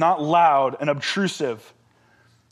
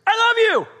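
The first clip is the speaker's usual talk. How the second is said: put-on high, loud blurt of a small kid; mock child's yell; 5 dB louder than usual. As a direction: yell it like a four-year-old into dad's ear. Imitates child yelling into his ear.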